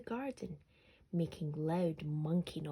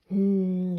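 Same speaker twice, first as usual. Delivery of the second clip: loud, whiny hum of complaint; whining sister; 13 dB louder than usual. A narrator's reading. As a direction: sister whining to mum about Alfie. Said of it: "whined Alfie's sister".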